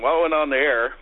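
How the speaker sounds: ordinary delivery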